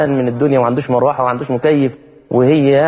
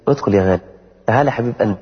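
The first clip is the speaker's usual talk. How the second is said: tender welcome; 4 semitones lower, faster than usual. Pretend. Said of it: "بالراحة شوية عليه".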